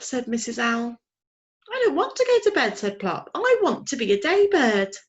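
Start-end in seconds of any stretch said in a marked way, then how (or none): 1.7-4.9 put-on high, fast young owl refusing bed; a little owl pushing back; whiny owlet protest. A narrator's reading. Plop refusing bedtime to his mother, high and whiny.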